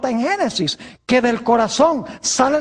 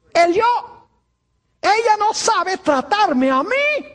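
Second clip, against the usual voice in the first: indignant husband; 7 semitones higher than usual. Acted. Acting the selfish husband.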